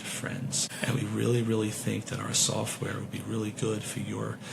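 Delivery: low, soft droning sales rep; imitating a monotone salesman; monotone sales pitch. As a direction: drone on like a dull salesman. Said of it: "talking like this in this monotone voice".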